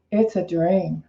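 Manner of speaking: normal talking voice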